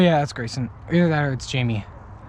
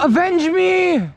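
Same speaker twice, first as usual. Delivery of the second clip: overacted death scene; 9 semitones higher, much slower than usual. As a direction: stretch out a dying hero's last cry. Shot, plays dying hero.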